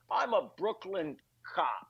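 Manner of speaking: slow lightly voiced Scottish-accented Connery; a hint of Connery's Scottish accent, drawn out; Sean Connery impression. Comedian mocks Sean Connery playing a Brooklyn cop, lightly Scottish. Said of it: "he's like".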